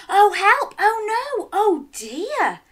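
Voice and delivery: high, loud hammed-up worry; mock alarm. A narrator's reading. Narrator voices the farm's hammed-up alarm, high and loud. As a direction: play up the panic for children. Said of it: "eggs began to disappear".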